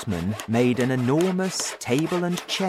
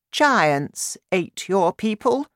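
high, slow regal voice; grave Queen; 8 semitones higher, 3 dB louder, slower than usual. A narrator's reading. Queen breaking news to the King, high and deliberate.

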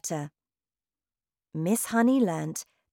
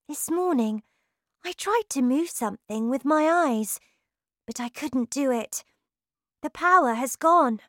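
put-on higher girl's voice, telling her teacher; a little girl sharing sad news; lightly voiced child; young Matilda; 7 semitones higher than usual.

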